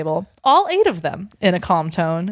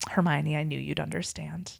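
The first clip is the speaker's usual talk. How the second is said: hushed ending; 3 semitones lower, 12 dB quieter than usual. Acted. Soft ominous ending.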